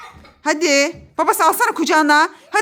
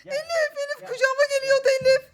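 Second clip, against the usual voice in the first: high, breathless frightened crying; mother tells her daughter how scared she got; 8 semitones higher, 4 dB quieter than usual. Real, not acted. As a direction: gasp it out after a real scare. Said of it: "ödüm koptu".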